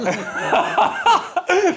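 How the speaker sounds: high burst of laughter; loud laugh. Real, not acted. Laughs at friend's remark.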